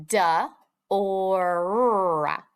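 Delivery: "D, or" split into separate sounds, the end drawn out. Shown as a demonstration. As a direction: sound out "D, or" with a gap between. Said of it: "each sound you hear in the word".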